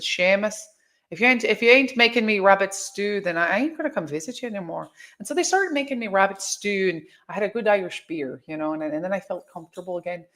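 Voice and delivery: storytelling in a put-on Irish brogue; Irish accent. Demanding rabbit stew from Seamus, told in Irish accent. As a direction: spin the yarn in an Irish accent. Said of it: "You're pretty good at the Irish accent".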